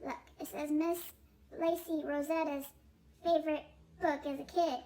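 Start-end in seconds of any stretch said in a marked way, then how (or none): none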